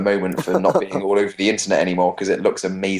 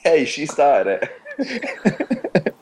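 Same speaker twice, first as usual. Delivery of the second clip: laughing; 7 semitones higher, much slower than usual. Real, not acted. Laughing defence.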